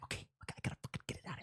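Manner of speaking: unvoiced panicked whisper; muttered aside. Comic retelling the Tesla handover, whispering in panic. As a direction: hiss it to yourself in a panic.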